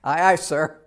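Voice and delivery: loud, snappy aye aye to the captain; crisp naval reply. Retold or imitated. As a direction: snap to attention with your voice. Answering the captain's summons.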